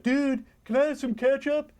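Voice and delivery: very high silly customer voice; cartoon customer. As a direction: pipe up as a goofy cartoon customer. Acted customer in the pitch.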